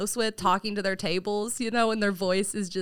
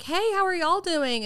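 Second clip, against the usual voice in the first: high, slow chirpy server greeting; bright customer service hello; 10 semitones higher than usual. Retold or imitated. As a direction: greet the table like a cheerful waitress. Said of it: "When you'd be like".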